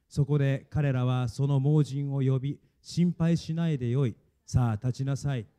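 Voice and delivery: measured, even-toned congregational reading; scripture reading aloud. Real, not acted. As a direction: read evenly so others can follow along. Reads scripture together with congregation.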